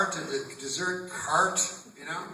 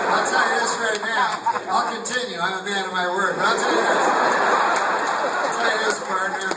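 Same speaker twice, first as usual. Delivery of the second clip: higher, loud, insistent declaration; mock-stern warning; 5 semitones higher, 7 dB louder than usual. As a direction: lay down the law to the waiter. Diner sternly warns the waiter.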